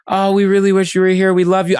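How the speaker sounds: hammed-up over-sweet friends on the phone; mock gushing friend. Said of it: "and she was like".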